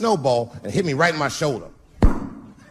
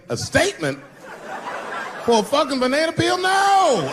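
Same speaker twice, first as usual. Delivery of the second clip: outraged disbelief; 11 semitones higher than usual. Retold or imitated. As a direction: scoff like it's absurd, voice shooting up. Indignant retort to press.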